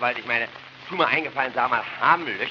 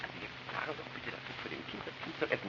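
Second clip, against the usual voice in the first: fast, softer hushed whisper; whispered aside; 2 semitones higher, 14 dB quieter, faster than usual. Acted. Mother whispering to father to make the boy eat. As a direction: hiss it so the child won't hear. Wife hissing aside to husband.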